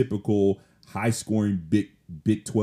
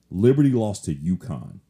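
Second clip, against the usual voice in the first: usual speaking voice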